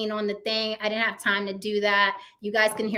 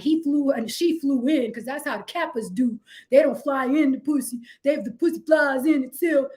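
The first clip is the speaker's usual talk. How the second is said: bragging friends; 2 semitones higher than usual. Retold or imitated. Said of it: "Kevin Samuels' friends are like".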